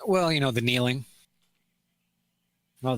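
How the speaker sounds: ordinary talk, nothing marked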